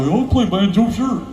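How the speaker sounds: high-pitched mocking yokel; eager banjo wannabes. Mocking ten thousand Nashville guys who play banjo, eager. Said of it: "ten thousand guys go".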